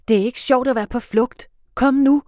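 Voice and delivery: loud, high impatient call; impatient shout. A narrator's reading. Felix annoyed they laugh, impatient shout. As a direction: scold them and hurry them. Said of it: "råber Felix".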